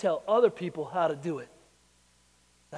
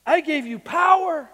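proclaiming God's words; 10 semitones higher, 10 dB louder than usual. Acted. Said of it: "he says".

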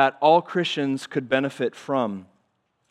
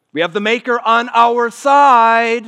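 higher, much louder, slow proclamation; triumphant preached cry; slow, booming declaration; 8 semitones higher, 9 dB louder than usual. Acted. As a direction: lean on every word from the pulpit. Preaching climax.

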